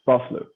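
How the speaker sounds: nail "Vosloo" through a laugh; laughing Vosloo retry. Shown as a demonstration. Host retries "Vosloo" laughing after the guest corrects him. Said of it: "there you go. Well done".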